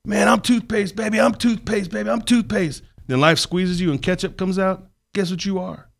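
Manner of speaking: high, loud preacher-style mentor voice; mentor's voice. Retold or imitated. Quoting his mentor's toothpaste lesson to the host, high and loud. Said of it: "He said".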